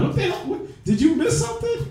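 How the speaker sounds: high, laughing what the hell; incredulous laughing jab; laughing disbelief. Real, not acted.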